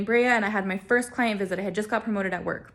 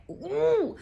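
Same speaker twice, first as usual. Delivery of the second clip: high-pitched pent-up "ooh"; acted high groan of frustration; frustrated ooh; 10 semitones higher than usual. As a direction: let out a high, tight ooh. Reacting to unanswered calls.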